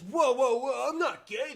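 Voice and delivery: high, loud startled protest; startled whoa. Retold or imitated. Cornered dad loudly protesting to the daughter, startled. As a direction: blurt it out in alarm. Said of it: "He goes".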